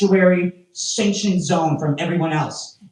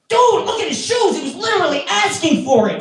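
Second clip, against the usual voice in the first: put-on mean girl; 12 semitones higher than usual. Mean girl mocking shoes in Starbucks, high and scornful. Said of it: "some mean girl".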